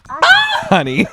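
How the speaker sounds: a high laughing cry of honey; laughing shriek. Real, not acted. Teasing her cohost with a laughing shriek. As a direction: squeal it through laughter.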